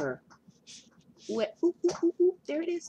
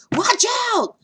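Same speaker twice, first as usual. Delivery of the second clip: sudden high loud warning shout; yell; 10 dB louder than usual. Acted. Flea yelling a warning to dog. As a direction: shout the warning at full force. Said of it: "Jasper yelled".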